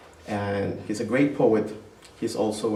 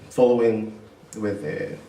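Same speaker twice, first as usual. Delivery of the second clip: ordinary talk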